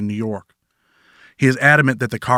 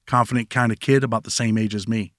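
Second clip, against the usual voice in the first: ordinary delivery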